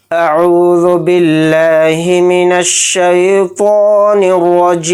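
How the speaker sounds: slow, drawn-out chanted tilawat; Quranic recitation. Acted. Teacher opening the lesson, slow chanted recitation. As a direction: intone each word slowly and reverently. Teacher opens with ta'awwuz and basmala.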